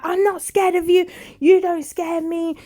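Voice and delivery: loud high defiant shout; loud high defiant yell. Retold or imitated. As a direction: hurl it back, ready to fight. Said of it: "She was ready to fight him".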